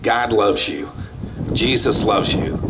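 normal talking voice

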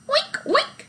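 high squealing oink oink; very high piggy oinks. A narrator's reading. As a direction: squeal like a happy pig. Pig Mercy oinks.

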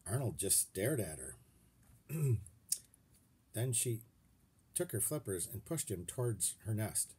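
usual speaking voice

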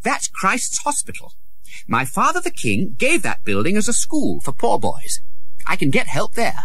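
put-on high young prince voice, growing eager; hopeful boy prince. A narrator's reading. Prince seeing his father's school, high and hopeful. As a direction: sure help is near.

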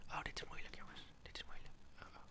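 very soft, whispered worry; hushed aside. Real, not acted. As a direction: whisper so the animal stays put. Whispers so as not to scare cat.

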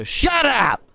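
sudden short shout to cut a friend off; snapping shout. Real, not acted. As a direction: bark it at your buddy, half joking. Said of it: "Sorry, that was kinda rude".

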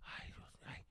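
soft whispered lament; whispered sigh. Retold or imitated. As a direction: breathe it out in a whisper. Mom muttering.

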